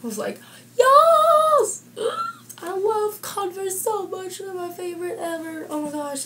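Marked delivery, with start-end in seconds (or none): 0.8-1.8 squealed high yes; excited. Retold or imitated. Excited reply to nan. 2.6-6.2 gushing. Real, not acted. Gushing over her Converse.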